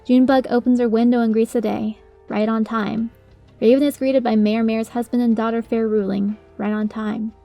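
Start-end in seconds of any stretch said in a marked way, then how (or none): none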